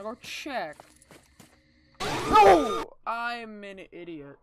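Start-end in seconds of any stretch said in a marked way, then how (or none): 2.0-2.9 sudden high scream of fright; scared scream. Real, not acted. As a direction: yell out in real shock. Jump scare in horror game.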